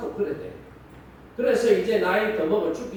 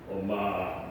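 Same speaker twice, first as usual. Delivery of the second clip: 엄마 said much lower in a mock baritone; put-on baritone; 13 semitones lower, 4 dB quieter, much slower than usual. Shown as a demonstration. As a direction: drop 엄마 way down into a deep faked baritone. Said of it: "목소리도 막 바리톤으로 바꾸고".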